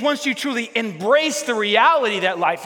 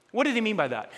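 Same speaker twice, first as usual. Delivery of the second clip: ordinary talk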